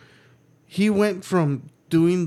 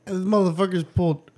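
ordinary talk

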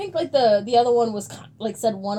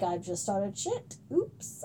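sheepish whisper; 4 semitones lower, 11 dB quieter, much slower than usual. Retold or imitated. Sheepish admission after stirring drama.

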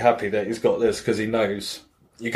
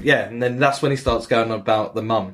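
ordinary talk, nothing marked

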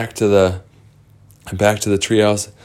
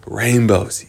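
ordinary delivery

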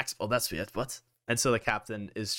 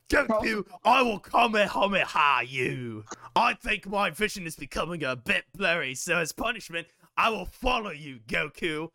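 higher, put-on captain voice; hammed-up threatening captain, pitched up; ship captain; 6 semitones higher than usual. Captain threatens Goku with punishment, high put-on voice. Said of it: "The captain says".

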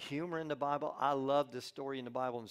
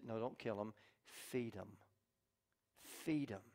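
quiet, softer and lower; gentle restraint; 4 semitones lower, 8 dB quieter than usual. Acted. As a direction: say it softly, calming them down. Elisha gently restrains them.

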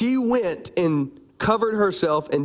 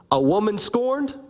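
ordinary talk

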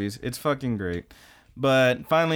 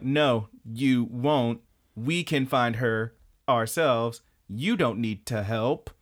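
slow, word-by-word acted refusal; measured, insistent no; firm refusal; slower than usual. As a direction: shut the offer down, slowly and deliberately. Karen firmly refuses Amano's help.

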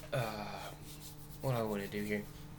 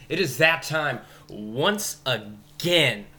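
slow, booming ringmaster announcement, louder; 4 dB louder, slower than usual. Pretend. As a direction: draw it out like a big-top announcer. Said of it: "Let me clear my throat here".

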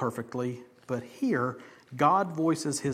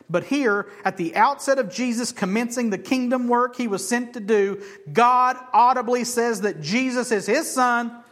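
much higher and louder, preaching hard; rising proclamation; 8 semitones higher, 7 dB louder than usual. Real, not acted. Preacher driving home God's words to the congregation, loud. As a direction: build the point with force.

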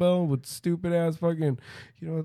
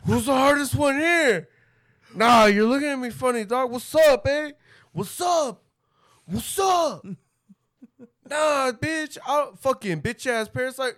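loud, high, laughing challenge; mock tough guy yelling at the daycare; 8 semitones higher, 4 dB louder than usual. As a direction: call out the room like a bully. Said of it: "goes to the daycare and is like".

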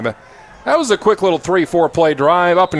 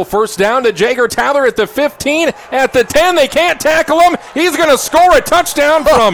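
touchdown call; 7 semitones higher than usual. Real, not acted. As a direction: build the call as he breaks free. Touchdown run called live.

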